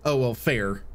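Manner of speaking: ordinary talk, nothing marked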